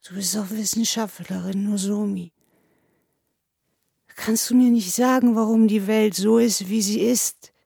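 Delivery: acted, plaintive whine; weepy appeal, rising in pitch; tearful pleading. A narrator's reading. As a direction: plead like an upset little boy. Crying man pleads with the narrator.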